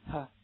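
"哈" as pure breath, vocal cords still; voiceless h. Shown as a demonstration. Teacher showing students a voiceless consonant, breathed h. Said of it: "声带千万不要震动".

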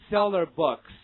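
"seller books" loud and run together as a model; linked reading. Shown as a demonstration. Teacher drilling h linking for students, loud linked reading. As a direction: say "seller books" loudly as one joined unit. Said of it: "大家一起跟我读一下啊".

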